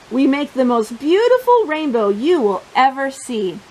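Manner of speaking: plain reading voice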